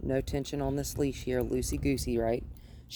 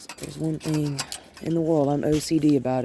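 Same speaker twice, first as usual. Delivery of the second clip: normal talking voice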